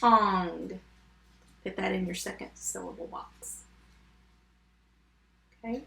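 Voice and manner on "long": "Long" with the L split off from the rest; sounded out. Shown as a demonstration. Teacher doing long for spellers, sounded out. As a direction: separate the first sound of "Long". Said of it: "Did you see that one?".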